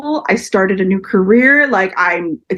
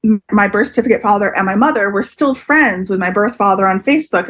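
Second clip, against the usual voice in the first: usual speaking voice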